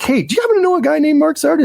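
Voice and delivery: high, fast mock cop voice; mock Columbo-style agent asking a casual question; imitating the agent. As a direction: play the sly detective asking casually. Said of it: "it's like".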